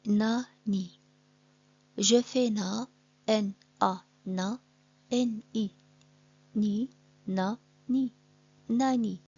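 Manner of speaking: teacher blending syllables; sounding out a word. Shown as a demonstration. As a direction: split nani into na and ni, then blend. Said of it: "Par exemple, le mot".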